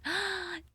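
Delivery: high, soft surprised gasp; sharp intake of delighted surprise. Real, not acted. Streamer spots a familiar viewer, delighted gasp.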